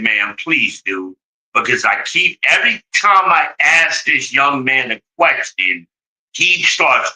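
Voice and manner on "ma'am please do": calm, relieved plea. Retold or imitated. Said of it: "I said".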